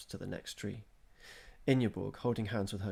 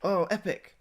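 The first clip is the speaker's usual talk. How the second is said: shouted; 11 semitones higher, 9 dB louder than usual. A narrator's reading. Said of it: "shouted Eric".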